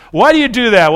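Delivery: mock outraged challenge; accusing Pharisees. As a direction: throw the accusation at him.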